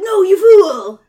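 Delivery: high, very loud warning yell; dramatic shout. Acted. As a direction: yell it in full alarm. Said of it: "He's like".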